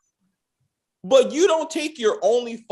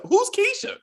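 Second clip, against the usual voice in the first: mock confused. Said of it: "It's like".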